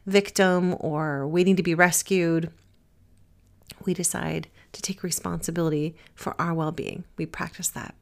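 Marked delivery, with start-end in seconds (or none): none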